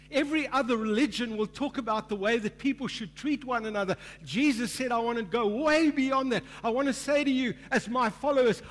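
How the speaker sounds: loud, high, driving sermon voice; rising revival-style proclamation; full-force preaching. Acted. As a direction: preach it out to the whole hall.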